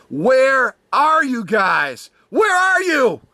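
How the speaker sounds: drawn-out shout, climbing high; calling out. Retold or imitated. Host calling out the California people, building to a high shout. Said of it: "I called the California people out".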